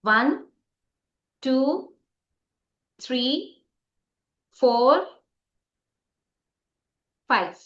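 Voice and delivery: long pauses between each number, a teacher counting to a class; teacher drawing out a countdown before the clap; slow count. Shown as a demonstration. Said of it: "ध्यान से सुनिएगा".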